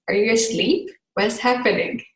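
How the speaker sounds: ordinary talk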